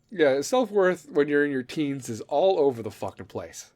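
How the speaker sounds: ordinary talk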